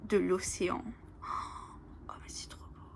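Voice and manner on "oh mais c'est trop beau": hushed, breathless whisper of wonder; awed whisper. Real, not acted. Discovering a beautiful card for viewers, awed whisper. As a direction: whisper it like a treasure you just found. Admiring oracle cards, awed.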